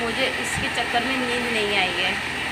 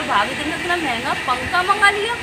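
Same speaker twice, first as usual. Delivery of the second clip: amazed devar; 7 semitones higher than usual. Retold or imitated. Brother-in-law asking bhabhi about the costly fan, mock amazed and high. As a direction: mimic his disbelief at the price. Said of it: "मुझसे पूछ रहा".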